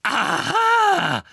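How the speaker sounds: very high, loud screech; piercing accusing cry; triumphant shriek. A narrator's reading. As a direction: pounce on it with a shriek. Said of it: "kreischte Professor Umbridge".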